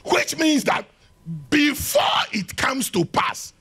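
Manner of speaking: high, loud, slowing on the second half; rising preacher's cry; preaching shout. Acted. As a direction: hammer it home from the pulpit. Preacher driving the point home.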